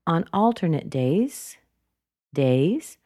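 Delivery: "days" swept up each time; unfinished, rising "days"; rising intonation. Shown as a demonstration. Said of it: "She's continuing on".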